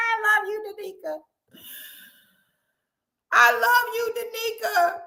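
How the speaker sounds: high, giggling I love you; squeaky amused affection; laughing love. Real, not acted. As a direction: tease her through laughter.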